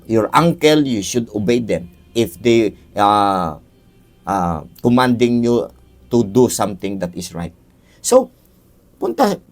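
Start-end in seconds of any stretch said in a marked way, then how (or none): 0.0-7.6 low, slow, measured scolding; stern parent lecture. Acted. Father explaining the spank to his child, low and slow. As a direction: lay down the rules slowly like a firm father.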